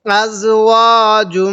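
slow recitation with "জুম" ending in a held hum; ghunna. Shown as a demonstration. Teacher applying the rule, nasal held ending on "জুম". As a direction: draw out "ওয়া", then hum the m of "জুম". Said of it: "এজন্য আমরা গুণনা করব".

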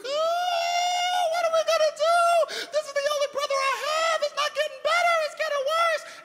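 high, panicked, crying wail; panicked Mary. Acted. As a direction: play the panicking sister, voice way up. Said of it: "Mary is having a panic attack".

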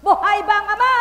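loud, high fiery preaching; preaching at full force. Acted. Preacher calling out to the congregation, fiery and loud. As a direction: call it out to the crowd.

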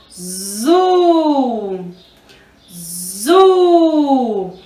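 ZU with a long, held U; teacher sounding out a long "zu"; syllable sound demo. Shown as a demonstration. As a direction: buzz the Z, then stretch the U in "zu". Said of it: "O som do Z com o".

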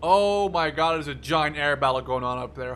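loud burst of amazement; awed. Real, not acted. Gamer seeing a giant air battle overhead, loud and awed. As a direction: blurt it out in wonder at the sky.

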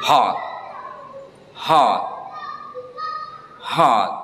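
Hot with a short open British o. Shown as a demonstration. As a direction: say Hot as hot, rounded o, no American a. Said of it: "Saya contohkan".

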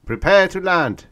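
high, loud announced command; intercom order. A narrator's reading. Pilot announcing landing to the cabin, loud. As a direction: call it over the intercom.

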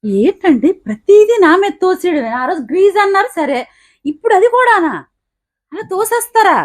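high-pitched, aggrieved wife; indignant protest. Acted. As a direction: object hotly to being blamed. Wife protests at being blamed. (కోపంగా నిలదీయడం)

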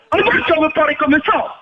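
ordinary talk, nothing marked

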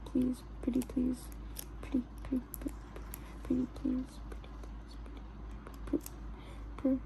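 very soft, tiny begging murmur; hushed pleading. Real, not acted. Begging the piece to stop turning up, hushed pleading. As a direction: beg under your breath, very softly.

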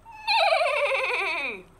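high long whinny; horse neigh. Acted. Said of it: "said Horse".

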